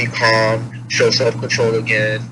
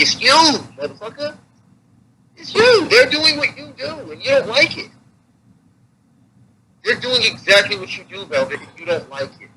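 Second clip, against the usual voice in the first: imagined rant; 11 semitones higher than usual. Retold or imitated.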